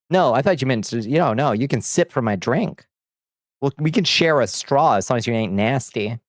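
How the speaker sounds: normal talking voice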